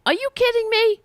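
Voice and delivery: squeaky high disbelief, jumps way up; can't believe what he's reading; incredulous. Real, not acted. Reading the Bernie bro article, high outraged disbelief.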